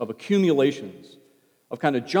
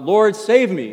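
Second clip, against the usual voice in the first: higher, louder "Lord, save me" as a desperate call; cry for help; 8 semitones higher, 11 dB louder, slower than usual. Acted. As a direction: call out like a man sinking in the waves. Sinking Peter calls out.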